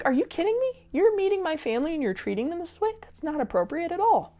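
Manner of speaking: put-on high, indignant disbelief at a rude boyfriend; indignant outburst. Girlfriend scolding her rude boyfriend, high indignant disbelief. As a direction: pitch it up in mock outrage. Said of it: "I'd be like".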